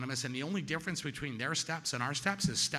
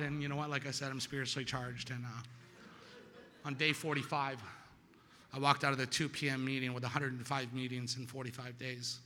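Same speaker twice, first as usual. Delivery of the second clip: ordinary talk, nothing marked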